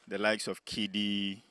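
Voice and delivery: ordinary talk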